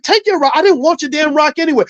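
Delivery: very high, exasperated comeback, acted out; reenacted squabble, high and heated; retold angry retort. Retold quarrel with the vendor.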